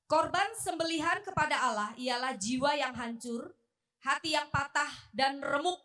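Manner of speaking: loud, declaimed Bible verse; scripture reading. Real, not acted. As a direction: read the verse out firmly to the congregation. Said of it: "Saya bacakan". (membacakan ayat dengan lantang)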